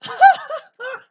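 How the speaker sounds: sudden high laughing outburst; big high laugh from the gut; loud burst of laughter. Real, not acted. Laughs at wrestling moment.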